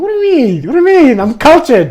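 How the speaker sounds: very high, loud affronted squeal; mock outrage. To guys calling him effeminate, mock outraged. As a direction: squawk it in hammed-up offence. Acted protest at being called effeminate.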